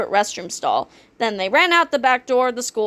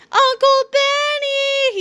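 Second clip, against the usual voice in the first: high, slow drawn-out wail; 3 dB louder, much slower than usual. A narrator's reading. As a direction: wail for help like a baby. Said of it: "he wailed".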